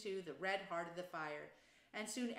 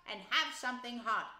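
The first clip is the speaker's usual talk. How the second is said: loud, raised, hearty welcome; jolly host calling guests inside; hearty invitation; 5 semitones higher, 8 dB louder than usual. A narrator's reading. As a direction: usher everyone in heartily. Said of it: "cried the rat heartily".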